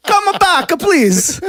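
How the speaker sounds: high, pleading fake Spanish accent; mock Spanish accent. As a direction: beg in a hammed-up Spanish accent. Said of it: "That, that's my Spanish accent".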